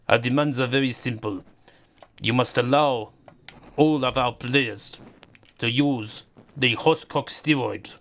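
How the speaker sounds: Putin impression. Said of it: "Putin threatening Serbia".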